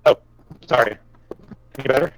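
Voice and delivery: usual speaking voice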